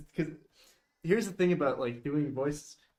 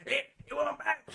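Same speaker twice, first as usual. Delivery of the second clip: high hillbilly holler imitation; hillbilly video impression; 10 semitones higher than usual. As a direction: imitate the backwoods snake man. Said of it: "Have you seen that fucking video?".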